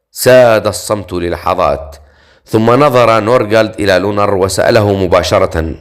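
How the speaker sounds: ordinary delivery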